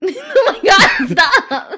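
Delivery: high, loud laugh through words; cracking up while talking. Real, not acted. Cohost cracks up at look.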